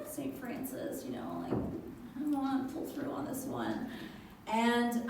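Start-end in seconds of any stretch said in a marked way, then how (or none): 0.1-3.9 soft, half-whispered mock prayer; hushed retold praying; little prayer. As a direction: murmur a plea to a saint. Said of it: "I said a little prayer over her".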